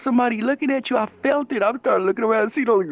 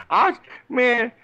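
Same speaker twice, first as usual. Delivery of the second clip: usual speaking voice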